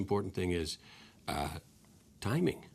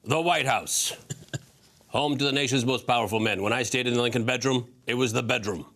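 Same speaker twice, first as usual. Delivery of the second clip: Larry King impression; 3 semitones higher, 7 dB louder than usual. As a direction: belt it out as a mimicked veteran interviewer. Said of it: "you do a great impression of me".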